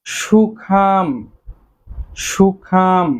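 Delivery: সুখাম said very slowly, with the final b silent; careful pronunciation. Shown as a demonstration. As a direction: stretch সুখাম out as a model. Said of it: "বি এর উচ্চারণ হবে না".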